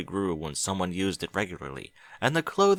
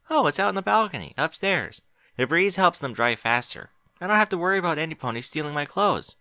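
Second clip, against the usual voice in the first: put-on higher, quick young mare voice; Twilight; 7 semitones higher than usual. A narrator's reading. Twilight telling Applejack where the clothesline is, higher quick voice. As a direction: explain it lightly and quickly.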